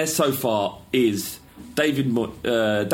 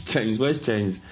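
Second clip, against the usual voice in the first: usual speaking voice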